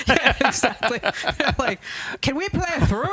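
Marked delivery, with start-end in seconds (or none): 2.2-3.1 high mock-polite golfer asking to play through. Golfers ask to play through, high and polite.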